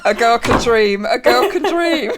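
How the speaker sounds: slow, laughing tease. Real, not acted. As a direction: drag it out, giggling. Teasing, laughing.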